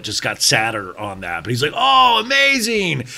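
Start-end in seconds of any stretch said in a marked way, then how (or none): 1.8-3.0 high, slow sarcastic gush; mock fake enthusiasm. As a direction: drag out a fake happy reply. Kyle faking enthusiasm.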